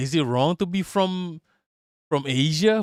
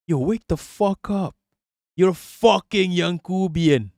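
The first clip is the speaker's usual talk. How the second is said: ordinary delivery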